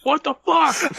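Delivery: high, indignant fanboy cry; mock outrage. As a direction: play the cheated fan, hammed up. Acting the cheated fan in theater.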